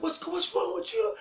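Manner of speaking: high, loud, flustered dad; hammed-up rattled father; startled daddy. Startled daddy answers screaming child.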